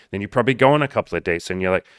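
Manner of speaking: normal talking voice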